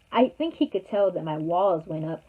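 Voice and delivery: normal talking voice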